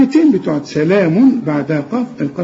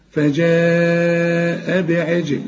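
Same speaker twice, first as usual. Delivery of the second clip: فجاء drawn out very slowly, ج bounced; slow Quran recitation; much slower than usual. Shown as a demonstration. Teacher to student: slow chanted verse with qalqala on ج. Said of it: "لازم تقلقل الجيم".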